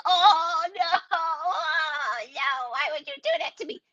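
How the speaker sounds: mock a client whining oh no; whiny mock client. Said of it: "Don't be like".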